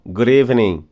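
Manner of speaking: "Good evening." ending without the G, D linked as R; dropped final G. Shown as a demonstration. Teacher reminding students to drop the G. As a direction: say "Good evening." as "goo-revenin". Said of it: "não leu o G no final".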